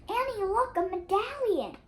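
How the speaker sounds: high, loud eager call to his sister; bright shout of discovery, pitched high; excited call. Acted. Jack calls about medallion.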